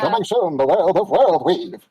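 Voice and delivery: slow, drawn-out trailer voice; trailer announcer. Acted. Host announcing the new game like a trailer voiceover. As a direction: sell it like a movie trailer.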